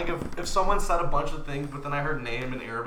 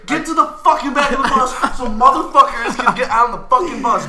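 loud, high angry rant; 9 semitones higher, 10 dB louder than usual. Real, not acted. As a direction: yell it in frustration. Ranting about bus riders.

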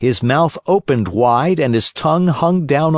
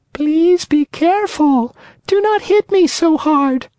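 beseeching wee voice. Acted. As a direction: beg him like a small frightened thing. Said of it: "a wee little voice say in a beseeching tone".

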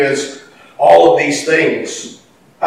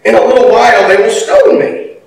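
raised-pitch desperate appeal, read aloud; crying to God; 5 semitones higher, 4 dB louder than usual. A narrator's reading. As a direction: plead upward to the Lord, getting louder. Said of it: "Then Moses cried to the Lord".

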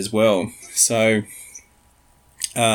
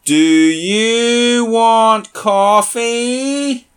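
very slow, loud, higher over-enunciation; uber slow talking; 11 semitones higher, 6 dB louder, much slower than usual. Shown as a demonstration. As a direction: stretch every word as if to a deaf person. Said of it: "That sort of uber slow talking".

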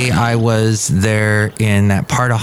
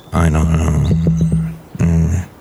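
deep character voice; 7 semitones lower, slower than usual. Acted. John Deere dazed.

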